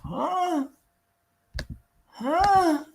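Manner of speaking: stunned huh reacting to the guest's claim; disbelieving huh. Real, not acted. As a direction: sound like you can't believe your ears.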